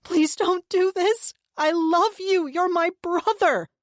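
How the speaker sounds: high, loud tearful begging; sobbing plea. A narrator's reading. Sister sobbing, begging her brother. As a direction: beg through sobs. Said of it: "Anna sobbed".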